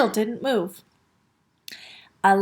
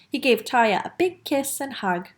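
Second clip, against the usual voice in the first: ordinary delivery, nothing marked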